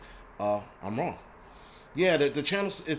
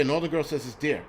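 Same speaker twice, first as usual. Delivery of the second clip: ordinary talk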